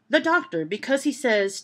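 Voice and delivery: normal talking voice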